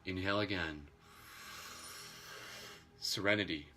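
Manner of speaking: soothing breathwork guide; guided meditation. Acted. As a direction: guide the breath calmly and gently. Leads chakra meditation.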